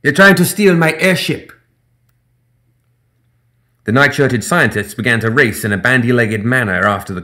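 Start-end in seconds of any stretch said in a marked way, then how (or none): 0.0-1.5 high, loud panicked cry; alarmed professor. Acted. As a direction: shout in outrage as it's stolen.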